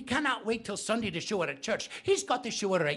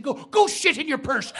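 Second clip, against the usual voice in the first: higher, louder spat retort; shouted insult; 8 semitones higher, 8 dB louder than usual. Acted. Molly throws the insult back at the neighbour, shouting. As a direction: fire it straight back at her.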